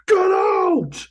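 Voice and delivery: high, loud yelled Get out!; angry shout. Retold or imitated. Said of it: "it was more like".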